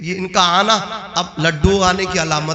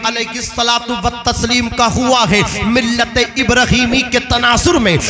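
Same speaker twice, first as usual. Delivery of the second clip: raised, driving sermon delivery; impassioned oratory pressing on without let-up; full-force preaching; 8 semitones higher, 4 dB louder than usual. Real, not acted. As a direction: preach in insistent, rhythmic phrases.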